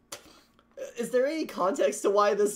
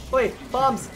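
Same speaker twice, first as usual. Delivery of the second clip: normal talking voice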